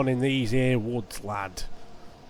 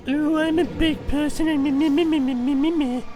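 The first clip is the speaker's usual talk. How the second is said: high, loud, sing-song play voice; silly high voice; 14 semitones higher, 5 dB louder than usual. Pretend. Player fooling around while playing, silly high sing-song. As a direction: squeak it out like a goofy little creature.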